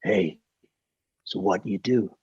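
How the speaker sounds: low, unhurried drawl of an American film star; Clint Eastwood. Retold or imitated. Film star sizing up a bodyguard, low impression. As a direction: lean into a quiet, low movie-legend voice. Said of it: "and in, in that accent".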